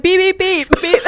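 high-pitched rapid mock beeps; imitated censor beep. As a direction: beep like a censor button over swearing. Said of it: "walked around with a bleep button".

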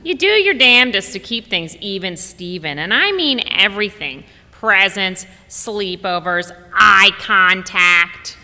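a mother laying down the law on fairness; hammed-up emphatic grandmother; emphatic grandma. Mom giving advice by phone.

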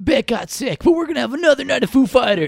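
high-pitched parody of an announcer; mock festival announcement. Host joking to co-host about a lineup change, mock high-pitched announcement. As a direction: call it out high, joking with the bad news.

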